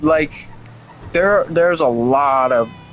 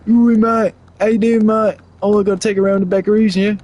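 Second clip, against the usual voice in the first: hammed-up British accent; 7 semitones higher than usual. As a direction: go full cartoon British bloke. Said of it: "in my head in a British accent".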